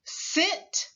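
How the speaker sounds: teacher drawing out the s; hissing s. Shown as a demonstration. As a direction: hiss the s of "Sit,". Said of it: "Repeat after me".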